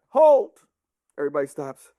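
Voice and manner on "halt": loud, high barked order; shouted command. Acted. King Arthur to hundreds of horsemen, shouted command. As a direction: stop a whole army with one word. King stops his army.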